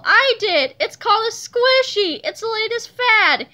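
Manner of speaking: high, loud, gleeful confession; amused crowing. A narrator's reading. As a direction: own up gleefully, pleased with the prank. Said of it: "laughed Miss Ribble".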